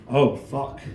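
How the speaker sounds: ordinary delivery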